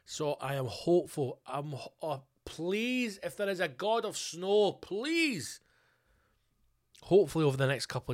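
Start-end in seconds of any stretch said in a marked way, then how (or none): 2.4-5.6 high, drawn-out begging; mock pleading. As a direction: beg with a falling wail on PLEASE. Begging a god of snow.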